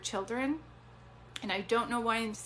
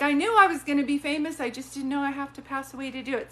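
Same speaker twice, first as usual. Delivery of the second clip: loud, high bragging quip; joking boast; 4 semitones higher, 7 dB louder, faster than usual. Retold or imitated. Medium voicing Mason's loud joking boast about being famous. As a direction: toss off the joke with a loud boast.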